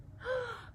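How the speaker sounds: high soft breathy gasp of delight; mock gasp. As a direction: gasp in surprise for the children. Discovering pigeon dreaming.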